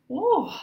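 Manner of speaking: loud high whoop to shake it off; high woo. Real, not acted. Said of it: "Let me catch my breath".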